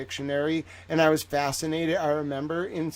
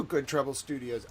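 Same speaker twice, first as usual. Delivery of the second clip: usual speaking voice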